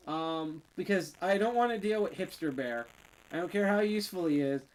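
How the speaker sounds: usual speaking voice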